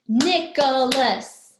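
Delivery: NICHOLAS in three loud, slow beats; syllable by syllable. Shown as a demonstration. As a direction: clap out NICH, O, LAS in NICHOLAS. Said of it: "has three syllables".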